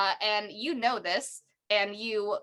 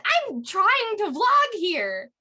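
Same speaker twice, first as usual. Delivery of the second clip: high, loud, slow indignant yell; annoyed shout; 10 semitones higher, 6 dB louder, much slower than usual. Acted. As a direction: snap at them for ruining the vlog. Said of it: "and he's like".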